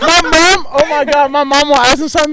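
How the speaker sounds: loud, high and excited. Real, not acted. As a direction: burst out telling the story. Excited telling about mom.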